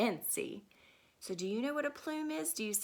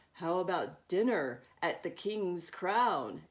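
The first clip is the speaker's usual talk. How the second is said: lightly voiced dad; 6 semitones lower than usual. Dad suggesting dinner out, lightly voiced lower. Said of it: "Dad suggests".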